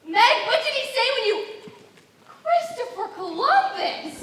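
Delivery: high, loud shocked cry; shocked exclamation. Acted. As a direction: burst out in outraged astonishment. Aunt returns and is shocked.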